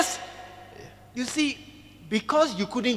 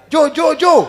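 loud call down a bad line; shouting into phone; 6 semitones higher, 8 dB louder, slower than usual. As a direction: yell the name into the phone.

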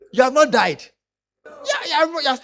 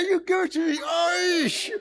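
high bold call; mock brave patient; 7 semitones higher, slower than usual. Preacher retelling herself daring the nurse, high. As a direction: sound braver than you feel. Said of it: "I said".

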